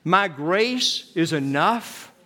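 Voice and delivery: each word drawn out slowly, led for all to repeat; slow congregational recitation. Shown as a demonstration. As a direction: say it slowly together with the people. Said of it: "Could you say that with me?".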